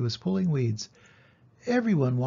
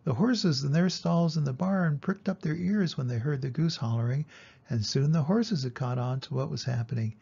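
ordinary delivery, nothing marked

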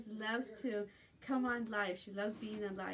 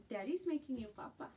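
normal talking voice

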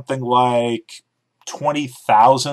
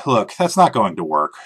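usual speaking voice

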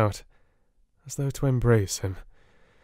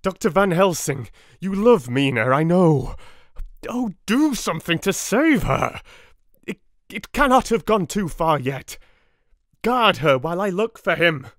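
high, loud desperate entreaty; urgent pleading; 9 semitones higher, 4 dB louder than usual. A narrator's reading. Husband begging the professor to guard his wife, desperately. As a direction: plead fast and frightened. Harker begs Van Helsing.

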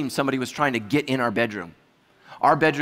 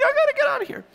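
small panicked squeak; much faster than usual. Retold or imitated. Sleepwalking wife at night, imitated tiny falsetto panic. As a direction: squeak out a mock escape plea.

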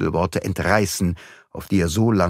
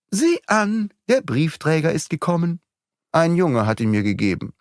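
Madame's voice; 8 semitones higher than usual. A narrator's reading. Madame speaks to servant.

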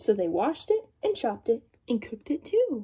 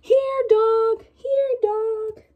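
high, louder calling voice; daughter calling the dog; 10 semitones higher, 4 dB louder, much slower than usual. Acted. Daughter calling the dog to come pull, high call. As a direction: call the dog over brightly. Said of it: "the daughter called the dog".

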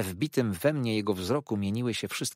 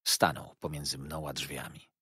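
normal talking voice

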